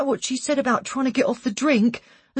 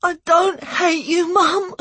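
high, tearful protest to Mum; crying out; 7 semitones higher, slower than usual. A narrator's reading. Son answering his tearful mum, crying out. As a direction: cry it out slowly, much higher. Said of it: "I cried".

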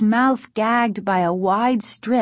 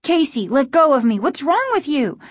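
raised, indignant protest; angry cry; 6 semitones higher, 3 dB louder than usual. A narrator's reading. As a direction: demand to be released, higher and louder. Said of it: "Margaret cried angrily".

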